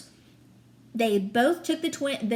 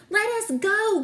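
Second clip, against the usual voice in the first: demanding shout; 10 semitones higher than usual. Acted. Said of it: "Connor demanded".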